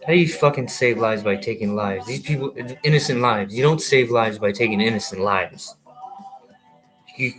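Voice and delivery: ordinary delivery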